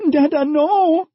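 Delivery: put-on a scared child begging, breaking off; young girl pleading to keep her family together; frightened girl. A narrator's reading. Girl protests.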